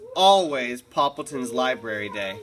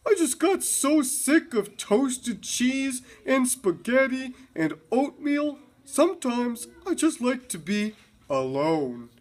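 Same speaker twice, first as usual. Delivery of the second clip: voicing Poppleton; 10 semitones higher than usual. A narrator's reading. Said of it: "said Poppleton".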